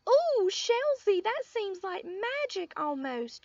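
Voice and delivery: high, loud awed ooh, slowed; awed little girl. A narrator's reading. Lucille amazed at Sheldon's magic shirt, high awed girl. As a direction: gasp it out full of wonder.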